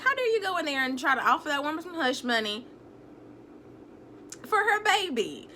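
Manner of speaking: high, scolding disbelief; mock-outraged telling-off; indignant outrage. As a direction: scold her as if she's right there. Voices own outrage at character.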